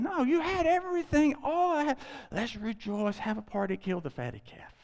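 the Lord urges celebration, high and joyful; joyful father. Acted. Eager celebratory appeal.

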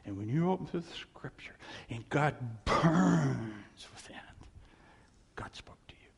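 hushed and unvoiced; preacher whispering how God spoke to him; awed whisper. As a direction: whisper it like a secret.

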